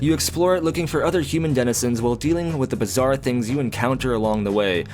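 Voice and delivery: ordinary delivery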